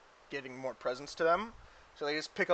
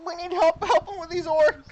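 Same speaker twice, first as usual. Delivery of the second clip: very high, eager cartoon helper; Mister Meeseeks; 13 semitones higher, 9 dB louder than usual. Game master as newly summoned Meeseeks introducing himself, high Meeseeks voice. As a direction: squeak it out brightly, then fret. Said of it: "And they summon another Meeseeks".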